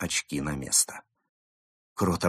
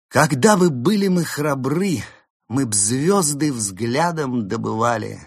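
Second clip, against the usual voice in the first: poem recitation; 8 semitones higher, 7 dB louder than usual. Acted.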